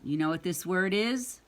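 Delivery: ordinary delivery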